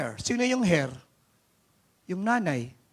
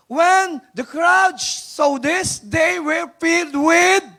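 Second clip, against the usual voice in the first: slow, loud preaching read; 9 semitones higher, 8 dB louder, much slower than usual. Acted. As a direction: stretch each word out and proclaim it loudly. Reads the verse to the crowd.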